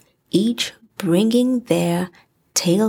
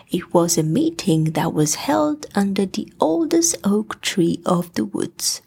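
ordinary delivery, nothing marked

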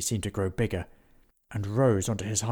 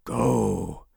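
growled; 5 dB louder than usual. Acted. Willie driving off the necromancer, growling. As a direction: growl the word at him. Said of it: "growled Willie".

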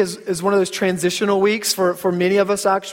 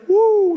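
high, drawn-out "woo"; warning whoop; 13 semitones higher, 4 dB louder than usual. Retold or imitated. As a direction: let out a comic high woo of caution. Acted parental warning about the troublemaker.

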